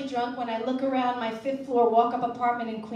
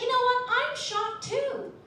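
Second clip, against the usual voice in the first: mock shocked; 11 semitones higher than usual. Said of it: "I was like".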